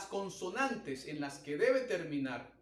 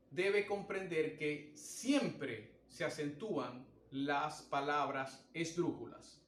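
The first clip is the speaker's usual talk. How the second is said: normal talking voice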